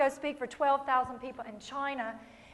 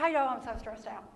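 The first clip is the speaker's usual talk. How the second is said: soft, droopy moaning friend; mock stressed friend; 5 semitones lower, 9 dB quieter than usual.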